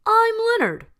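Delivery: high, loud cheery greeting; bright storybook lion voice; friendly lion hello. A narrator's reading. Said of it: "said Leonard".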